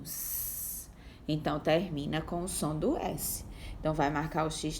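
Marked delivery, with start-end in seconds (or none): 0.0-0.9 S as a long voiceless hiss; a long hissing S for the class. Shown as a demonstration. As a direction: hiss the S sound alone. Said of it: "Olha o finalzinho".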